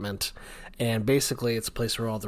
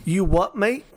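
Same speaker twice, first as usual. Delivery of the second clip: loud, higher British accent, hammed up; mock British accent; 7 semitones higher, 6 dB louder than usual. Joking with co-host about British humour, mock British accent. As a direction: put on a cheeky British challenge. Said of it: "That was my British accent".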